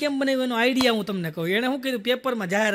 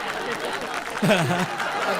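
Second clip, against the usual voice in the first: laugh; 5 semitones lower than usual. Real, not acted. Laughing at own joke.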